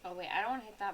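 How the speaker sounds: normal talking voice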